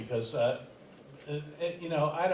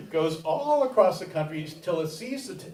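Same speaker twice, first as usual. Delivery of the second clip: normal talking voice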